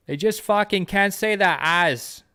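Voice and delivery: slow, high Boston accent, dropped R's. Shown as a demonstration. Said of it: "It's fucking Boston, kid".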